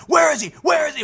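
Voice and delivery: high-pitched raid shout; urgent demanding yell. Retold or imitated. Armed cops burst in looking for a man, urgent high shout. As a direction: bark it out like a search in progress.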